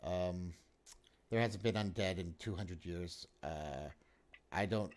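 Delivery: a disbelieving mayor, pitched low. Acted. Mayor doubts the undead.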